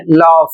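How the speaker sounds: लॉफ ending in a clear F sound; GH as F in laugh. Shown as a demonstration. Teacher correcting students who say laag, gh as F. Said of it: "इसको पढ़ना है".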